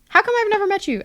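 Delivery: high-pitched imitation of a puzzled coworker; retold amazed question.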